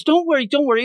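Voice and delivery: high-pitched mock reassurance; high reassuring. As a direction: squeak out quick comfort. Reassuring character quote.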